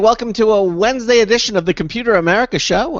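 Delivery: upbeat announcer opening; bright on-air welcome; radio host intro. Acted.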